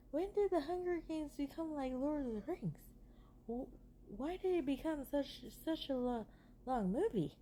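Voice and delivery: high, exasperated disbelief at the runtime; incredulous rant. Real, not acted. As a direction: pitch shoots up in baffled outrage.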